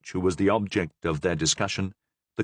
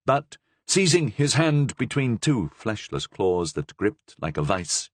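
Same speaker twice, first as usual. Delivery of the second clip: usual speaking voice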